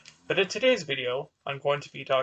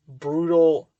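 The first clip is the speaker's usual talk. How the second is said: BRUTAL hit hard and loud; brutal, set apart and heavily stressed; 7 dB louder than usual. Real, not acted. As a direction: punch the word brutal, louder than the rest. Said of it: "emphasis on the brutal".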